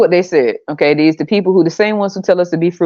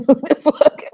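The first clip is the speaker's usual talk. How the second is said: normal talking voice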